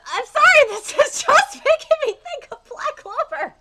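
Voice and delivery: high, loud, laughing gush; giddy excitement. Real, not acted. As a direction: gush loudly through a laugh. Gushing over manga resemblance.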